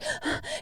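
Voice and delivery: a sharp unvoiced gasp of fright; frightened gasp. Shown as a demonstration. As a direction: gasp like someone in a horror movie. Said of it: "that's what you hear".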